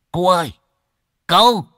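high, loud hail; calling out. Acted. Old man hailing a farm worker, calling out loudly. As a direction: call across the fence. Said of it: "mà liền gọi".

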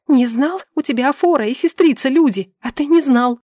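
high, heated disbelief; indignant retort. Acted. Wife mocks husband's ignorance, indignant retort. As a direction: fling his excuse back at him.

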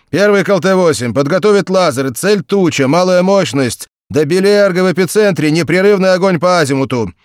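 loud, high commanding order; radio command. A narrator's reading. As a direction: give firing orders into the mic. Commander orders via microphone.

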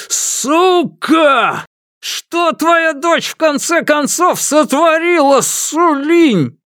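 high, slow, frantic shriek; hysterical scream. A narrator's reading. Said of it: "истерично закричал".

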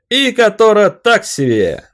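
very high, loud, slow yell; yelling at the top of lungs. Acted. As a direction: bellow it to the crowd. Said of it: "Вопю во всё горло".

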